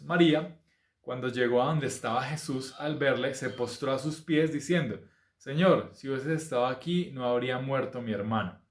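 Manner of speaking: lightly heightened scripture reading. Acted. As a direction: read the passage out to the congregation. Reads scripture aloud.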